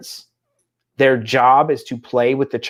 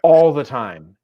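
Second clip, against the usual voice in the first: exasperated parent retort. Retold or imitated. Parents' exasperated reply to child.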